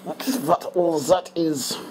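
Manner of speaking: slow, half-hearted mock German accent, psoriasis as thoreiathith; a deliberately bad German accent, slowly; poor German accent. Explaining his dry skin to viewers in a poor German accent. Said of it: "That was a very poor German accent there".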